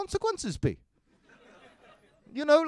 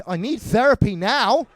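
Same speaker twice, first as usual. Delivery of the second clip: ordinary talk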